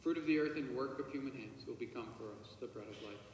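ordinary delivery

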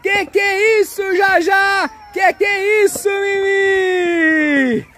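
high, drawn-out astonished exclamations; playful surprise. Real, not acted. Dad showing the girls the tow truck, high mock-amazed shouts.

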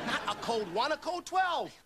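TWELVE jumps high and loud, set against ONE; correcting emphasis. Acted. Agent correcting Pillows about the code, emphatic. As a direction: correct him, punching twelve.

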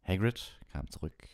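ordinary delivery, nothing marked

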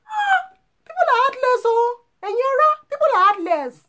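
very high, loud, crying wail; wailing lament. Acted. Lamenting heartless people.

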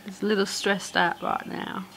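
ordinary talk

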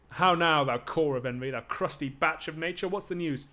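mock him from above; Achilles taunting. Voices Achilles mocking Thersites.

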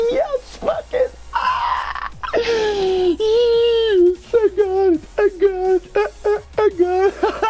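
very high, laughing squeals of joy; ecstatic screaming. Real, not acted. Gamer celebrates winning an exotic helmet, screaming ecstatically. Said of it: "sorry, guys, for screaming".

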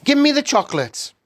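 high, quick, loud demand; boy shouting. A narrator's reading. Boy grown suspicious of the witch, shouting. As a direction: shout it fast, suddenly suspicious. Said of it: "shouted Bruno, becoming suddenly suspicious".

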